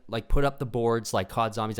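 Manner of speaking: ordinary talk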